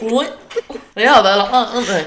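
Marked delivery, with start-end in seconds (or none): 0.0-0.8 mock oink. Imitating animal noise.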